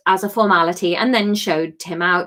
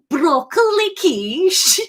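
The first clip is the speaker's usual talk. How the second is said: slow, higher Liverpudlian Greek "broccoli quiche"; Liverpudlian Greek accent; 6 semitones higher, slower than usual. Shown as a demonstration. Streamer reworks the Liverpudlian Greek accent. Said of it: "I don't know what a Greek accent is".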